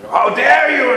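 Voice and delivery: scolding. Shown as a demonstration. Father-in-law scolding his son-in-law, shouting angrily. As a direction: bark the line at him. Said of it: "a father-in-law scolding the son-in-law".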